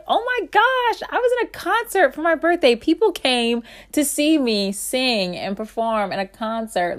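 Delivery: high and fast, bubbling over; squealing with birthday joy; giddy excitement. Retold or imitated. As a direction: gush like you just can't believe it happened. Gushing over her birthday concert.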